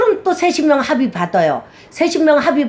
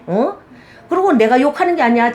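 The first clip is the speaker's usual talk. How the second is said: usual speaking voice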